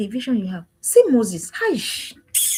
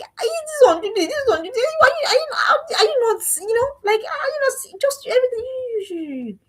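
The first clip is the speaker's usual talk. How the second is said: much higher, half-laughing exasperated pleading; exasperated squeal; 10 semitones higher than usual. Retold or imitated. Mother pleading with her youngest daughter, high and exasperated. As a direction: shriek it out at the youngest like a fed-up mum. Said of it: "And I'll be like".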